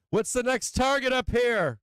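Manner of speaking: high, slow, loud gleeful question; excited cheering. Real, not acted. Trader to viewers, thrilled and drawn out. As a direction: savour every word of the win.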